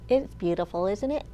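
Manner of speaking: ordinary talk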